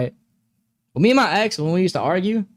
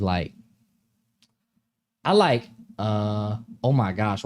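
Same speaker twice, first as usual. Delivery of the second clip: ordinary talk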